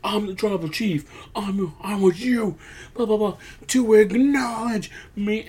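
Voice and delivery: high-pitched mock rant; lightly hammed-up outburst, pitched well above normal; Roman. Wrestling boss going off on Jay, mock rant. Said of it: "starts going off on Jay".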